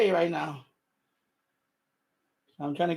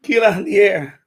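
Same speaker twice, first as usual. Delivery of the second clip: laughing; 4 semitones higher, 8 dB louder than usual. Real, not acted. Laughing through the line.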